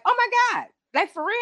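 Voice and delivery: high, squealing mock excitement; excited disbelief. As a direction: gush like you just heard great news. Her excited reaction, quoted.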